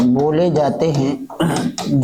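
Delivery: drawn out, each syllable slow; slow dictation. Shown as a demonstration. Teacher dictating notes, slow and drawn out. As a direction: spell it out slowly for the class to copy. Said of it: "teacher repeats slowly".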